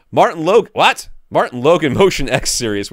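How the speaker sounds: high, loud disbelief; astonished reveal. Real, not acted. Reveal after drum roll, astonished.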